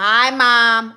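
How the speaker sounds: loud, drawn-out mock greeting; talking to Mom. Shown as a demonstration. Showing a viewer how she talks to her mom, loud greeting. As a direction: holler it out to your mother. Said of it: "This is how I talk to my mom".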